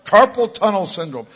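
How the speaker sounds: loud, high called-out announcement; intercom announcement. Retold or imitated. Young man calling out ailments on intercom, announcement voice. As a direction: proclaim it over a store speaker. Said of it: "he starts calling out these words".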